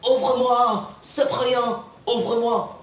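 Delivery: high, mock yelled plea through a door; mock yell from the girlfriend. Girlfriend shouting from the dressing room, mock yelled plea. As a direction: call out high like she's banging on the door. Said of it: "Elle me criait".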